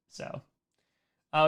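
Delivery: ordinary talk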